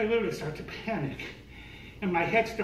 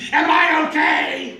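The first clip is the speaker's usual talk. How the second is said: much higher, louder, slow disbelieving cry; incredulous shout; 11 semitones higher, 11 dB louder, slower than usual. Retold or imitated.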